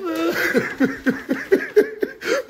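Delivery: high laugh. Real, not acted. Reacting to her emotion.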